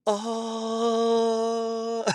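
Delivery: high drawn-out electronic whine; mock car noise. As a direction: imitate the car's reversing hum. Mimicking electric car reverse noise.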